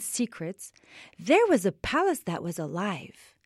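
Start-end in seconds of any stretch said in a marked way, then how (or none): none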